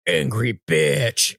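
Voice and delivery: sudden low, drawn-out mock menace; mock angry. Acting out her rage out of nowhere, mock angry. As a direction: drop low and drag out each word.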